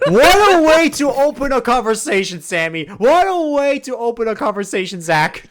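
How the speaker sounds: loud, high laughing disbelief. Real, not acted. GM laughing loudly at the player's blunt question. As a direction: crack up and shout it out.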